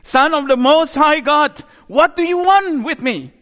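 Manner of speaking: high, loud demonic shout; full-voiced, high-pitched screamed plea; screaming loud demon voice. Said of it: "He screams at that time with a loud voice".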